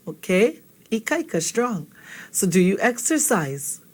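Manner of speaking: ordinary talk, nothing marked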